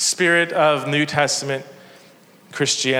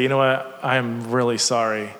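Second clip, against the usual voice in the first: sincere apology; 7 semitones lower, 5 dB quieter than usual. Pretend. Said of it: "give it to him and say".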